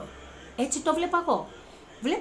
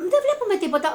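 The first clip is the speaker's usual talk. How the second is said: excited quote; 10 semitones higher, 6 dB louder, much faster than usual. Retold or imitated. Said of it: "Μου λέγανε".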